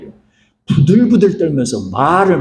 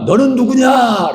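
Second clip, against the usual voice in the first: higher, slow stunned question; shocked father; 8 semitones higher, slower than usual. Retold or imitated. Blind Isaac confronting his son, stunned and higher. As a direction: ask as if the floor just dropped away. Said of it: "이삭이 크게 충격을 받습니다".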